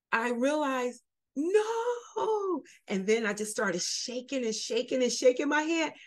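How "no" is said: much higher, crying out no; realizing her hair is gone, crying out; anguished cry. Retold or imitated. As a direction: break into a sob of disbelief. Hair falling out in braids.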